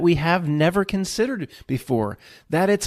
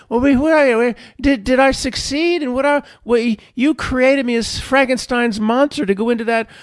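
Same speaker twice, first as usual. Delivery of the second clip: higher, anxious questioning voice; dazed returned soul; 6 semitones higher than usual. Acted. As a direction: stammer questions to the higher self. Returned [name] anxiously asks higher self.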